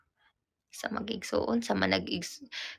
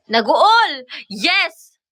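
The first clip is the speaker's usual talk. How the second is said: excited call-out; 7 semitones higher, 8 dB louder, much slower than usual. Shown as a demonstration. Tutor calling out the answer to her student, loud and high. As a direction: shout out "Naguol!" high and loud.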